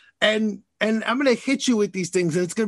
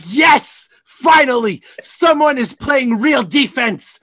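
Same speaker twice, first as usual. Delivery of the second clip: excited shout; 5 semitones higher, 6 dB louder, slower than usual. Retold or imitated. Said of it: "I was like".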